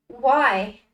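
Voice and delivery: desperate plea. Acted. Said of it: "desperate plea trembled in his voice".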